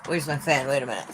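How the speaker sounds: ordinary delivery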